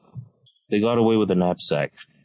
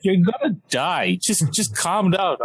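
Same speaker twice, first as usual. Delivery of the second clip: alarmed driver; 8 semitones higher than usual. Retold or imitated. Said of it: "he's like".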